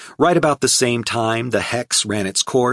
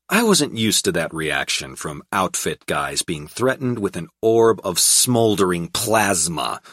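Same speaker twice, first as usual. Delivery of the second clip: ordinary delivery, nothing marked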